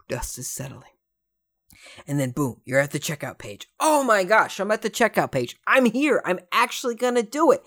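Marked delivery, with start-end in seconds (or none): 3.8-7.6 excited. Retold or imitated. Acted excited inner quote.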